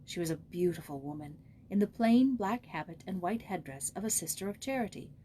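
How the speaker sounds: usual speaking voice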